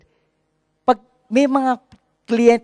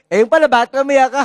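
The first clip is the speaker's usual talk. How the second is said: mock father; 7 semitones higher, 6 dB louder, faster than usual. Father answers child in story.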